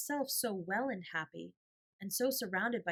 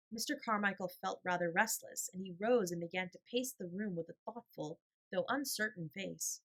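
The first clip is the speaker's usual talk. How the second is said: usual speaking voice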